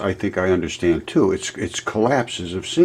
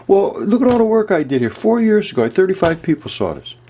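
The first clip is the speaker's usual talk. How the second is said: higher, pitched-up frustrated complaint; upset; 9 semitones higher than usual. Real, not acted. Complaining to viewers about few video views, upset. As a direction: vent the hurt of being ignored. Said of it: "That's why I'm upset".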